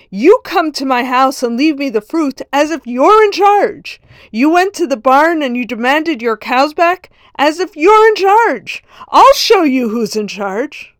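angry rebuke. Retold or imitated. Nobleman scolds the peasant.

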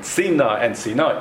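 first sina stressed on "si", second sina on "na"; contrasting syllable stress. Shown as a demonstration. Teacher asking what difference it makes, sina stressed first then last. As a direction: set sina against sina by moving the stress to the end. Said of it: "one's called mila ra, one's called mila el".

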